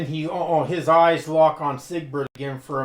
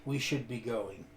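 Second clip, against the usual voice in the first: said simply; 4 semitones lower, 10 dB quieter than usual. Acted. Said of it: "he says simply".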